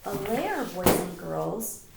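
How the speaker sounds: ordinary delivery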